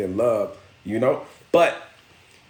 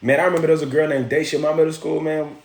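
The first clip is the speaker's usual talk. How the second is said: ordinary talk, nothing marked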